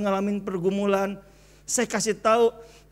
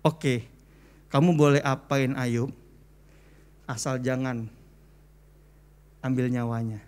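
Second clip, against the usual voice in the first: God granting permission; 9 semitones lower than usual. Acted. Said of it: "Tuhan bilang gini".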